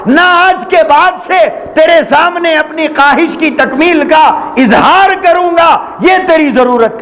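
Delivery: preacher's fervent climax; impassioned preaching. Acted. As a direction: proclaim it with fervour. Preacher voicing the repentant man.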